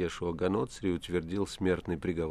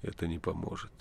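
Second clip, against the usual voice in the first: voice sinking; 3 semitones lower, 7 dB quieter than usual. A narrator's reading. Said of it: "тут голос Пилата сел".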